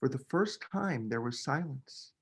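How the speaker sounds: quiet narration after the shout; hushed. A narrator's reading. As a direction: let it drop into the silence. Silence after Noah's holler.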